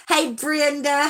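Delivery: ordinary delivery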